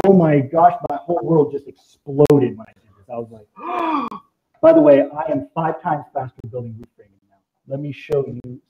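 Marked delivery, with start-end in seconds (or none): 3.1-4.2 gasp. Retold or imitated. World exploded retold.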